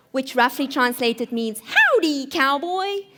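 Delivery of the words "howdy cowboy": high, drawn-out American cowboy greeting; put-on American accent, pitched up; attempted American accent. Shown as a demonstration. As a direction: try your best American howdy. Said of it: "my best attempt at, uh, American accent".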